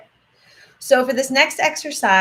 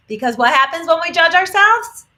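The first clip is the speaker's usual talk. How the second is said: emphatic question; 8 semitones higher, 9 dB louder than usual. Real, not acted. Teacher stresses a rhetorical question.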